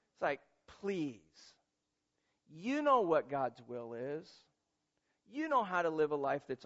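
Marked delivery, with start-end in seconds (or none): none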